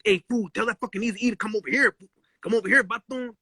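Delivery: a hint of a Chicano homeboy voice calling out; lightly voiced street homie calling out. Neighborhood cholos calling out to Willie, light Chicano homeboy voice. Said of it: "they said, like".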